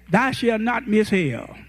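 normal talking voice

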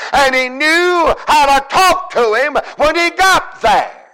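urgent preaching climbing to a high shout; full-force preaching. Real, not acted. Preacher praising how Jairus knew who to go to, climbing shout. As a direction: build from quick urgency to long, shouted key words.

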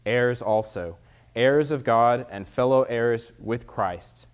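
loud, formal scripture reading. Acted. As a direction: read it out to the congregation. Reads scripture aloud.

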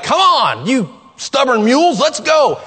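impatient mock cheer; eager urging. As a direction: hurry the stubborn mules along. Eager member urging change.

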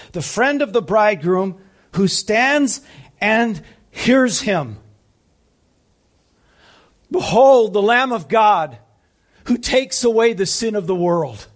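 builds to a loud proclamation, climbing high; rising preaching shout. Acted. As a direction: proclaim Behold like a cry to the crowd. Quoting the Baptist at full force.

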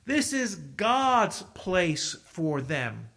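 rising preacher's build; fervent pulpit declaration; preaching. Acted. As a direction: preach it with growing force.

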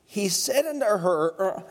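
usual speaking voice

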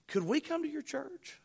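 tentative shy request; a little shy. Retold or imitated. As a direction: ask it timidly, voice rising. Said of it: "she was a little shy about it".

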